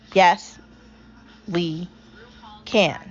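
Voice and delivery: Yes, we can with a long pause after each word; paused affirmation. Acted. Host tells listeners what their response should be, paced out.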